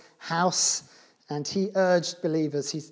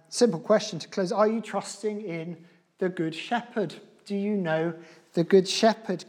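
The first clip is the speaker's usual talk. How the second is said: usual speaking voice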